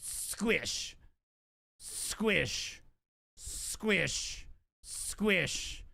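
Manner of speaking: soft squelchy squish, drawn out; squish sound. Retold or imitated. Imitating the trash bag's squish while moving it, soft and drawn out. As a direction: mimic the wet squish softly. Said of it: "it's going squish".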